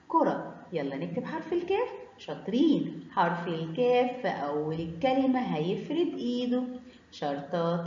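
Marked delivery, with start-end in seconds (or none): none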